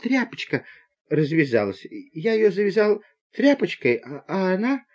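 put-on high, small, halting boy's voice; guilty stammer. Boy explaining the escaped snake to the conductor, guilty stammer.

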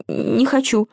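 small scared voice; frightened. A narrator's reading. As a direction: refuse with a touch of fear. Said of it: "испуганно отказался".

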